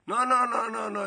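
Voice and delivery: rapid string of high, loud no's; acted firm scolding refusal; emphatic refusal. Kaki refuses the caught boy's plea.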